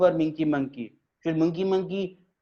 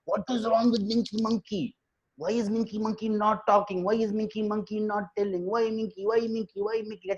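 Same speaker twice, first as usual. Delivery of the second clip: higher, hushed chorus of animals; worried gossiping friends; 5 semitones higher, 4 dB quieter than usual. Acted. Animals discussing their silent friend at a meeting, anxious gossip. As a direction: fret in a raised, softened pitch, repeating insistently.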